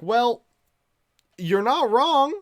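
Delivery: high, loud comic admission; mock concession. Acting out his conceding reply.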